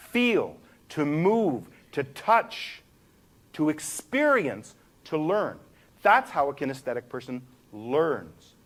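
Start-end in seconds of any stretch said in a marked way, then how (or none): none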